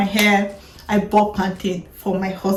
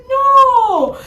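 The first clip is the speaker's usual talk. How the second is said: pleading protest; 9 semitones higher than usual. Retold or imitated. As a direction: plead with him in a much higher voice. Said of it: "And I'm like".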